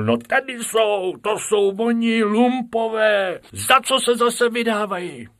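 an indignant teacher; teacher exclaims. Acted. Teacher Kalousek to the keeper about rabbits, indignant outcry. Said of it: "vykřiknul".

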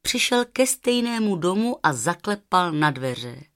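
plain reading voice

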